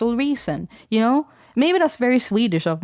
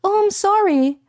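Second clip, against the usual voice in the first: mimicking a friend's high, apologetic little "sorry"; 12 semitones higher than usual. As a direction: squeak out a high, polite apology. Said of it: "she'd be like".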